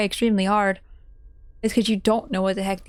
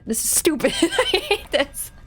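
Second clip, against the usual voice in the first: crying laugh; 6 semitones higher than usual. Real, not acted. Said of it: "I'm crying".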